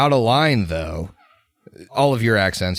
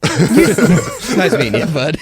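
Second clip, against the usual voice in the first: laughing; 8 semitones higher, 4 dB louder, much slower than usual. Real, not acted. Laughing greeting new player.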